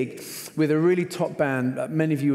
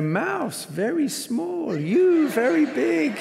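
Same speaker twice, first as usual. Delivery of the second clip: higher, slow, broken-English chuckle; amused hotel worker; 10 semitones higher, much slower than usual. Retold or imitated. Hotel worker teasing the scared guests, slow and amused. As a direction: say it slowly, amused and foreign. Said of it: "he says, you know".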